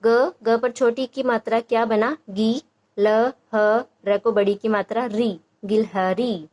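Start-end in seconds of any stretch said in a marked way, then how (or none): 2.3-6.5 गी, ल, ह, री spelled out slowly, one at a time; slow syllable by syllable. Shown as a demonstration. Teacher models letter-by-letter reading for parents, slowly. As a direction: sound each piece of गिलहरी out slowly, then blend. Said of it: "एक एक अक्षर को".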